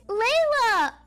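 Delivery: calling her name out loud with joy; excited welcome-home call. Acted. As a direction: greet her with a happy shout. Family greeting arriving daughter.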